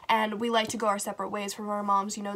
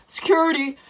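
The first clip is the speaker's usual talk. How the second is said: guard calling out; 6 semitones higher, 6 dB louder than usual. Retold or imitated. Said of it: "He knocks again".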